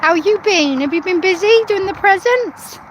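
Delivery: bubbly North Pole helper; christmas elf. Acted. Cheery North Pole greeting to Santa.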